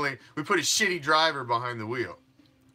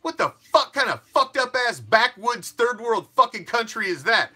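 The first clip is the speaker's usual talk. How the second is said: ranting; 6 semitones higher, 4 dB louder than usual. Real, not acted. Ranting at rival fans.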